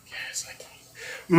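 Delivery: breathy whisper off to the side; whispered aside. Real, not acted. Cook whispering a quick reply off camera, hushed. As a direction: whisper it so viewers barely hear.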